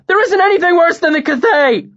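high, loud shout; terrified shout at his master. A narrator's reading. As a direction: slam it out like the fist. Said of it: "Bast shouted".

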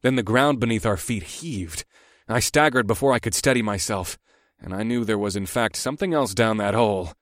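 usual speaking voice

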